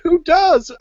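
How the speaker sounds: very high, loud squeak; cartoon quip. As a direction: squeak the comeback. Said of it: "He's like".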